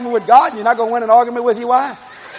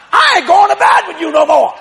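higher, louder defiant outburst; mock angry shout; 6 semitones higher, 4 dB louder than usual. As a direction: yell it like a sulking husband. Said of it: "I said".

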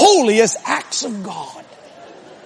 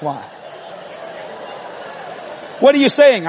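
normal talking voice

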